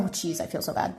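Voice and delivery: usual speaking voice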